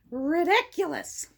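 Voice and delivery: plain reading voice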